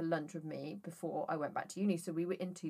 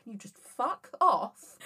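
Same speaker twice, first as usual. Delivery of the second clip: slow, raised, exasperated plea; 5 semitones higher, 5 dB louder, slower than usual. Retold or imitated. Driver retelling her snapping at beeping sensors, exasperated. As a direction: drag it out, louder and higher, fed up.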